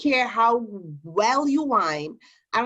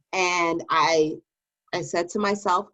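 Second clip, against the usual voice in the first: ordinary delivery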